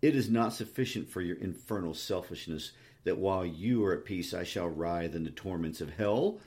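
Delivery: usual speaking voice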